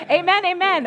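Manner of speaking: loud excited amen. Real, not acted. Pastor rallies congregation after song.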